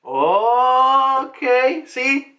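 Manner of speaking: long, high, loud okay; loud, rising drawn-out exclamation; dawning realization. Real, not acted. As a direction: stretch it out loudly as it clicks. Teller reacts to the wordplay.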